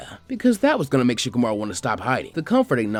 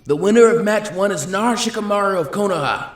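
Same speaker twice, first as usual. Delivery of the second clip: loud, higher formal call to the arena; official announcement; 9 semitones higher, 7 dB louder than usual. Acted. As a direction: announce it for the whole crowd. Proctor announces match result.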